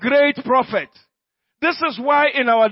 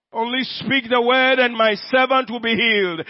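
ordinary talk, nothing marked

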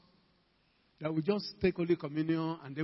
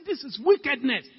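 stern rebuke; 6 semitones higher, 6 dB louder than usual. Retold or imitated. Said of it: "I was so serious".

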